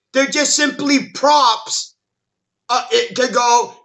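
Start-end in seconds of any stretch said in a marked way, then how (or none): none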